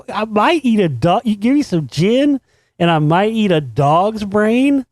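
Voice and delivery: high, slow, rising disbelief on each phrase; high, drawn-out incredulous question; incredulous repeat. Real, not acted. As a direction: repeat it as if you can't believe it.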